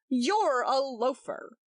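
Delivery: slow rude insult. A narrator's reading. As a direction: throw the insult deliberately. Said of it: "said Roger rudely".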